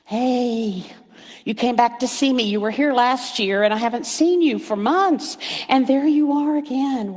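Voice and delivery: high, excited sing-song to the birds; talking sweetly to backyard birds; delighted to birds. Retold or imitated. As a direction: coo at a returning bird. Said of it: "and I'm like".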